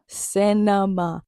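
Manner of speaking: Cinema stretched out very slowly; drawn-out praise. Shown as a demonstration. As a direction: savour each syllable of Cinema. Praising the final scene.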